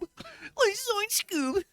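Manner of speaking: high squeaky Like zoinks Scoob; Shaggy voice. Shown as a demonstration. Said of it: "retake of zoinks line".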